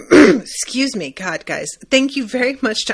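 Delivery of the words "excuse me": throaty after clearing throat; rough catch in the throat; frog in throat. Real, not acted. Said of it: "froggy today in my throat".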